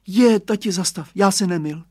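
put-on high, loud child's cry to stop; boy calling to his dad; exclaiming. A narrator's reading. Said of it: "zvolal Radovan".

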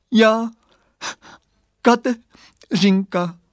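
put-on high sobbing little-girl voice; slow, gulping, tearful child; voice broken by crying. A narrator's reading. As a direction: tear the words apart with sobs. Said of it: "řekl hlásek pláčem potrhaný na kousky".

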